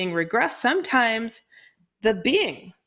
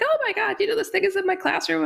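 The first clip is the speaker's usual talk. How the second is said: high, fast, panicked rush; 13 semitones higher, much faster than usual. Retold or imitated. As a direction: blurt it out in a high-pitched panic. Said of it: "she calls me in a panic".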